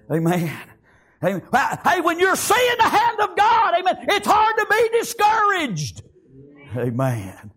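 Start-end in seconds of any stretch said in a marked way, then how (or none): 1.8-6.0 very high, shouted exhortation; full-force preaching. Real, not acted. Preacher encouraging his congregation, full-force shouted preaching. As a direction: cry it out to rouse the church.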